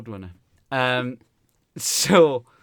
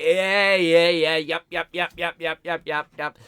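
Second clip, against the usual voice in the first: raised-voice rapid-fire brush-off; dismissive; 5 semitones higher, 8 dB louder than usual. Retold or imitated. Comic retelling cutting off offended woman, dismissive. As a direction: talk over the complaint with mock impatience. Brushing off heckler in retold exchange.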